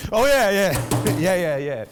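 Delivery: loud, high burst of remembering; the answer finally pops into his head; sudden recall. Real, not acted. Man suddenly recalling his story detail, loud and excited.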